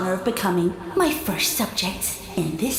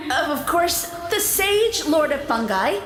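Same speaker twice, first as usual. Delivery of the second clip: ordinary delivery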